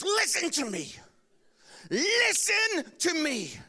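wife cutting her husband off, stern mock shout; angry wife. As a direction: hammer each word like a furious wife.

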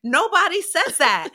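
high, loud protest to mom; disbelieving retort. Retold or imitated. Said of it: "And I'm like".

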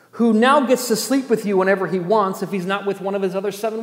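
loud, indignant preaching in driving phrases; impassioned preaching. Real, not acted. Preacher naming Bathsheba's trauma to the congregation, impassioned.

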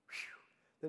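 ordinary delivery

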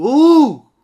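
Hugo with a long, loud HUUU; HUUU-go called out, first syllable stretched; stress on first syllable. Shown as a demonstration. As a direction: hold the U of Hugo and drop the ending. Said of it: "em que sílaba tónica é que vão meter?".

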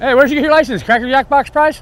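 loud, high taunt at a bad driver; road rage yelling. Real, not acted. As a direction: shout the insult at the passing car. Yells again at the driver.

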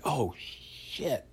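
a quiet sinking-heart oh no; dismayed curse. Retold or imitated. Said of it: "And I'm like".

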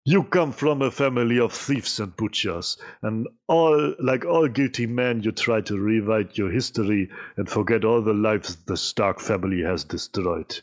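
put-on slow, heavy Russian accent; villain threat in a Russian accent. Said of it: "meinen besten russischen Akzent raussuchen".

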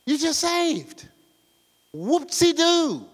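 slow taunting whoopsie-doo; mocking. Real, not acted. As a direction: drag it out mockingly. Mocking the merely saved.

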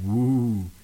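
low, drawn-out spooky woo; ghost voice. Retold or imitated. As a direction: moan it like a cartoon ghost. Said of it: "That's my best impression of a ghost".

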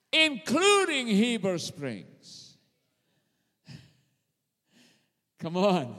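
loud, rising preacher's cry; preaching at full force. Acted. Preacher rousing the congregation, loud and fervent. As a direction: build the crowd up to a shout.

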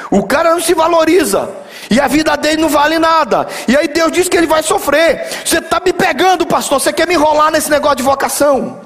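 high-pitched mock-indignant complaint; protesto indignado encenado. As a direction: act out an outraged churchgoer, pitch way up. Imita crente indignado com o pastor. (indignação encenada, voz bem aguda)

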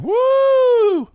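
high, loud, sustained "woo"; shrieked thrill-ride whoop; woman's whoop. Retold or imitated. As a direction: imitate a woman yelling on a ride.

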